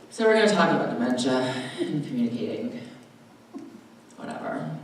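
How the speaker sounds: low, fast, bored run-through trailing off; bad mood, dismissive. Presenter in a bad mood addressing the class, dismissive. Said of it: "I was in a bad mood and I'm like".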